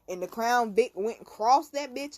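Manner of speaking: normal talking voice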